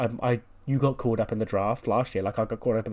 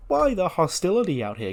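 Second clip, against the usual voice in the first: high-pitched mock dismay; 7 semitones higher, 3 dB louder than usual. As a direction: swoop up high as if appalled at the fuss. Acts out wrestler's mock-surprised entrance line.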